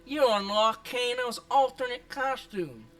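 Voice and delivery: hammed-up Aussie accent. Streamer reading Kano's costume description, put-on Australian accent. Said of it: "let me try to read the description".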